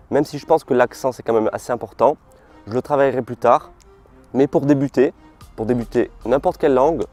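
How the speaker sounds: ordinary delivery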